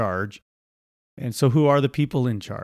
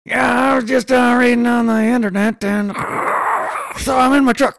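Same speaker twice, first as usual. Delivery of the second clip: much higher, louder shouting caller voice; mock caller; 13 semitones higher, 6 dB louder than usual. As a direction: yell it like a caller on a bad line from a truck. Pretend caller on the show.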